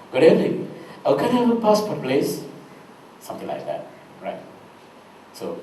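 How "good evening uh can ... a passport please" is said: lightly imitated visa officer accent; a hint of a UK immigration officer's accent. Shown as a demonstration. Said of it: "visa officer will ask".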